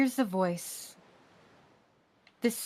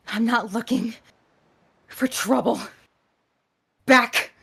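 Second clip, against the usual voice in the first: firm warrior woman, rising to loud; stern warning; 3 semitones higher, 8 dB louder than usual. Acted. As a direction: warn them off, getting louder at the end. Character threatens with staff.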